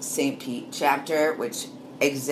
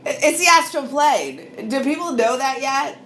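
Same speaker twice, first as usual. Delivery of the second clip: excited; 12 semitones higher, 5 dB louder than usual. Real, not acted. Telling listeners about the astral plane, excited high voice. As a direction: marvel out loud, high and hyped.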